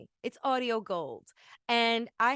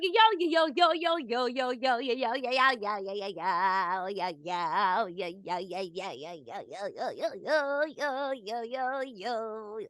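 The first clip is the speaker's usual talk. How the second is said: elf voice. Acted. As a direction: play a bouncy Christmas elf. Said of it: "That's my elf yo".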